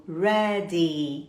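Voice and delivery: "ready" in two syllables with long final ee; carefully finished Y. Shown as a demonstration. Teacher recalling the rule for learners, "ready" with long ee. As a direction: take care over the Y of "ready", held as ee. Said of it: "você vai caprichar".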